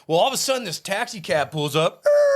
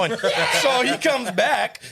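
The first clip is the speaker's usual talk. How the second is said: higher, slowed by laughter; laughing; 8 semitones higher, slower than usual. Real, not acted. Telling friends about the drunk guy, laughing. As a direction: laugh as you tell it.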